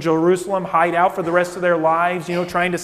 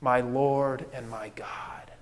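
soft, slow, hushed; awed confession; 5 semitones lower, 10 dB quieter, slower than usual. Retold or imitated. Thomas touching the wounds, quietly awed. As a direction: breathe it out in awe. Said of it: "he says".

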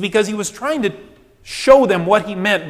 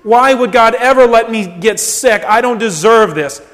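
higher, louder, self-pitying complaint; indignant whining; 6 semitones higher, 7 dB louder than usual. Acted. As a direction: whine at God like you're wronged. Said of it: "We grumble about our bodies".

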